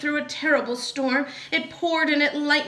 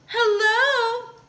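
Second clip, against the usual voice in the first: high, sing-song hello; smiling call; 10 semitones higher, 3 dB louder than usual. A narrator's reading. Lazy bird hails a passing elephant, smiling call. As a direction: call out sweetly with a smile. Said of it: "called the lazy bird, smiling her best".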